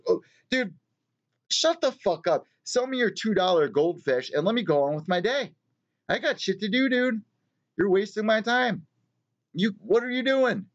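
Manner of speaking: high, fed-up mock telling-off; exasperated rant. Pet store customer to clerk, an exasperated high-pitched mock rant.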